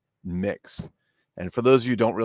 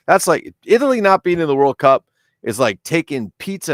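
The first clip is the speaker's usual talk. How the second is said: comic outrage; 8 semitones higher, 10 dB louder than usual. Retold or imitated. Comic outrage at Italy's absence.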